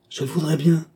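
a touch of winded panting on the reply; puffing out the words. A narrator's reading. Man stuck to the ceiling answers, mildly puffing. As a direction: push it out on a short puff of breath. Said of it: "fit-il en soufflant".

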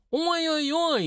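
very high creaky dwarf wail; shrill, creaking cry of dismay; piercing creaky wail. A narrator's reading. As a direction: wail piercingly like a tiny creature. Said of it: "ещё пронзительно скрипуче завопил карлик".

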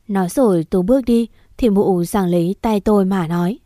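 usual speaking voice

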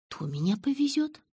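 high, puzzled question; uncomprehending. A narrator's reading. Narrator baffled by the offer of a lift, uncomprehending. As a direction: ask in baffled disbelief, pitch climbing. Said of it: "не понимала я".

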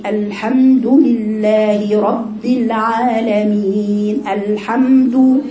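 very slow, drawn-out recitation with rounded "du" in الحمد; slow tajweed recitation; full damma demo. Shown as a demonstration. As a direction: stretch each syllable, pursing the lips on الحمد. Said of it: "شوف الضمة".

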